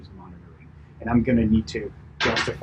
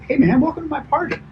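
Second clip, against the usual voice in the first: high, rising party-host voice; upward inflection; 4 dB louder, faster than usual. Shown as a demonstration. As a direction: pitch way up like a welcoming host. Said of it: "I am talking with an upward inflection".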